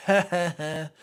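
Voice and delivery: mock laughter. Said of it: "They all laughing".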